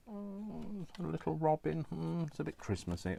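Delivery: high, soft coo; small high-pitched doting voice. Real, not acted. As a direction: coo softly at something tiny and sweet. Cooing over a robin picture.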